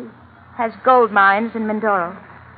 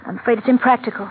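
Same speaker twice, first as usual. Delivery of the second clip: usual speaking voice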